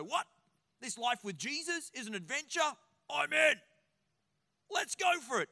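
eager convert. Retold or imitated. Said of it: "there'll be certain people that go".

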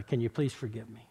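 hushed and humble, asking pardon; sincere apology. Retold or imitated. Retold plea for forgiveness.

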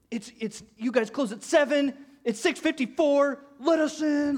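imitating an irate dad, high and loud; loud, high-pitched angry outburst, retold; going ballistic. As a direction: freak out at the man at the door. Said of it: "just starts going ballistic".

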